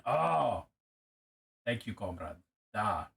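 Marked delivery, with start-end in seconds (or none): 1.6-3.1 light mock Russian accent, a touch lower. Thanking a friend in a mild mock Russian accent. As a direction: thank them like a Russian comrade. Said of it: "You had the accent really well for being Russian".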